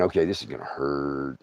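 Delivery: quiet resignation. Retold or imitated. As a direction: say it low, resigned. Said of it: "I'm thinking".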